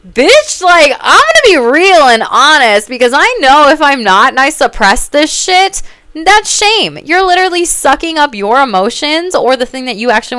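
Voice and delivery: real outburst, loud and high; fired-up rant. Real, not acted. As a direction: let it rip, furious and loud. Ranting against suppressing herself.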